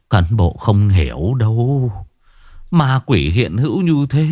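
put-on old man's voice rising in terror; frightened old man. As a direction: plead in rising fear.

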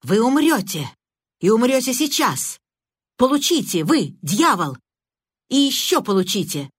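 a hint of yelling at a foe; shout. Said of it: "и затем крикнул". (громкий истеричный крик)

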